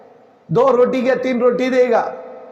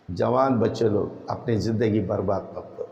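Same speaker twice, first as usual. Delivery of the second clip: hushed appeal; 13 semitones lower, 8 dB quieter than usual. Real, not acted. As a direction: drop right down, quieter and much lower. Preacher pleads with young listeners. (धीमी, नीची आवाज़ में समझाइश)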